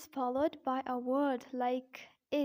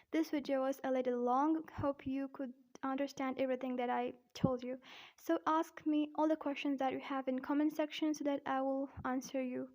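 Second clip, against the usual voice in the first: normal talking voice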